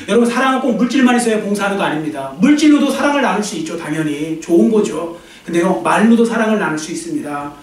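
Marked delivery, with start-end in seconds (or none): none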